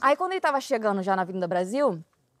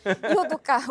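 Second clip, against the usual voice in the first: usual speaking voice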